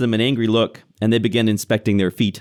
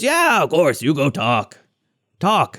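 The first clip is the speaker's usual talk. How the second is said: high-pitched goblin assent; permissive hobgoblin; 4 semitones higher, slower than usual. Acted. Hobgoblin telling the captive to go talk, overly agreeable. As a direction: wave the prisoner on in goblin voice.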